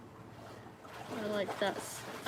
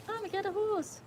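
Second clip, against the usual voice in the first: put-on much higher, childlike voice; little kid voice; 12 semitones higher than usual. Streamer imitating a little kid wanting a horse, high childlike. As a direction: play the excited little girl. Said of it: "The same voice".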